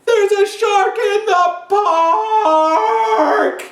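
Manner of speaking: very high, long drawn-out cry of alarm; loud, high storybook shout. Acted. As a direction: holler it across the park, stretching the last word. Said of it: "What do you think he's about to shout?".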